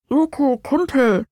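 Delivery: voice from far away. A narrator's reading. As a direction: call as if from very far off. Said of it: "drang die Stimme wie aus weiter Ferne".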